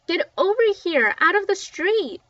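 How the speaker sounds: high, loud shout over the rain. A narrator's reading. Little Mac calling the kids out of the storm, shouting. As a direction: bellow them in off the street. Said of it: "shouted Little Mac".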